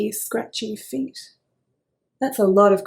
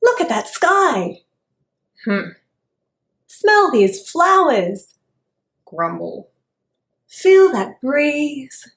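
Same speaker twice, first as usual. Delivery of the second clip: dreamy worm; 3 semitones higher, 7 dB louder than usual. Acted. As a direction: savour each word like a delighted little worm. Worm admires the day.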